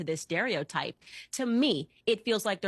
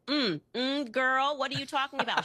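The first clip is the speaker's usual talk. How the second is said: higher, sassy mock "girl" voice; mocking Kamala; 6 semitones higher than usual. Guest mocking Kamala's put-on voice, higher. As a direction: pitch it up like a sassy impression.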